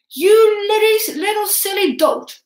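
high, loud, spitting scorn; furious scolding. A narrator's reading. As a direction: berate the foolish boy. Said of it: "he said".

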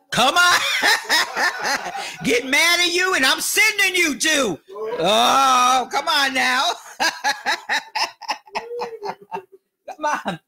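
high, laughing words breaking into giggles. Real, not acted. Preacher joking about church threats of hell, laughing. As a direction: crack up between the phrases. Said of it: "I'm laughing".

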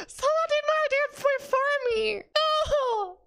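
very high, loud screamed lament; anguished wail. Real, not acted. Streamer beaten to the idea, high wailing scream. Wails that the idea was taken.

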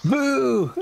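squeaky high-pitched boo; high mock boo. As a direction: boo up high like a cartoon heckler. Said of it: "You booing your own viewers".

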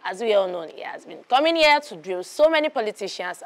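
ordinary talk, nothing marked

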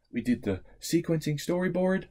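normal talking voice

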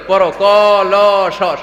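acted schoolteacher, each letter drawn out; primary teacher spelling. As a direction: drill the class letter by letter. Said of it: "primary স্কুলে শিখাইতেছে শিক্ষক".

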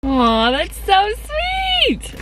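slow, high drawn-out aww; touched gushing. Real, not acted. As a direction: melt over a sweet card. Touched by a friend's card.

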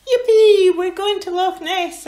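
very high, very loud squeal of joy; high loud excited squeal. Acted. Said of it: "Angus squealed".

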